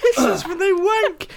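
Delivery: high, helpless laughing question; cracking up. Real, not acted. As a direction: ask it through fits of laughter. Cracking up at the joke.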